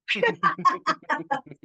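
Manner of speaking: high burst of laughter. Real, not acted. Amused by own mafioso comparison.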